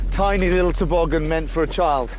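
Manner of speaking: ordinary talk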